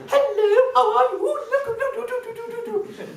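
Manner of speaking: put-on extremely high, loud baby-talk; talking to children. Shown as a demonstration. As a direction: coo excitedly at a small child in an exaggerated squeal. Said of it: "You work on extremes".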